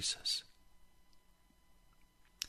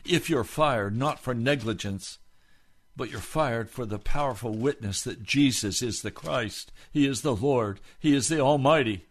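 rising, louder preacher's call, pressing each question; preaching at full force; 2 semitones higher, 6 dB louder than usual. Acted. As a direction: hammer the questions home to the congregation. Preaching build.

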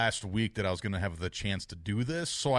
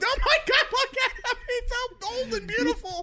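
very high, loud fanboy gushing; hammed-up squeal of starstruck awe, breaking into laughs; Chris Farley; 4 dB louder than usual. Fan retelling his meeting with his childhood captain, gushing. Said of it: "Turned into Chris Farley".

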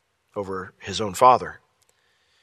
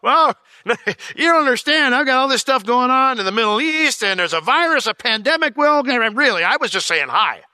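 high, loud exasperated rant; hammed-up harried busy boss; high, loud mock frazzled God, overwhelmed by the world's troubles; mock flustered Lord; 12 semitones higher, 7 dB louder than usual. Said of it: "Lord's like".

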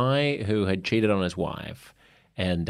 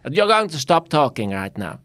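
Esther Perel impression; 6 semitones higher than usual. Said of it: "She did".

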